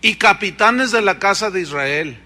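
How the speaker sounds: forceful scripture reading, loud and ringing; preaching. Acted. Preacher thundering scripture at the congregation, loud. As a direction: proclaim the verses to the congregation.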